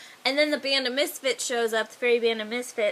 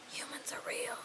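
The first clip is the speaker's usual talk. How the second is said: hushed, voiceless gasp of wonder; awed whisper; 15 dB quieter than usual. Acted. Fairy comes across a human in the forest, awed whisper. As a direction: whisper it in astonishment.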